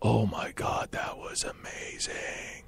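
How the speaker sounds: slow, voiceless whisper; awed whisper to a coworker. Retold or imitated. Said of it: "he just whispers to me".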